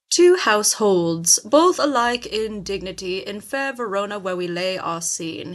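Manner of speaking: hammed-up British stage voice, slow and loud at the start; a narrator's over-the-top British delivery; exaggerated British accent. Mimicking the play's narrator in an exaggerated British accent. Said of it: "he said in an exaggerated British accent".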